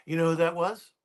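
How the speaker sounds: usual speaking voice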